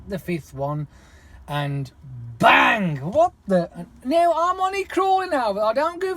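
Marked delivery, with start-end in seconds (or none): 2.4-3.0 sudden shouted bang, sharply higher; mimicking the crash of the hump; loud bang. Imitating the car hitting a hump. 3.0-3.9 shouted startled outburst. Retold or imitated. Reacting to the bang.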